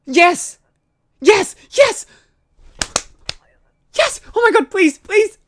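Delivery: loud, rising ecstatic yelling. Real, not acted. Streamer overjoyed at getting bribe money, shouting. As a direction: shout with disbelieving joy.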